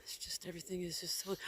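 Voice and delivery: fast, soft whisper. Real, not acted. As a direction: hush it under your breath. Whispering so camera won't catch.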